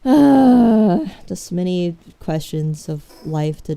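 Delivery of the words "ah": frustrated yell. Real, not acted. Frustrated outburst at game.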